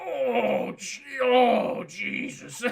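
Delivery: drawn-out groaning gasp; hammed-up pang, sinking at the end; mock stricken groan. Kenobi feeling billions die, mock groaning. Kenobi feels the planet's destruction.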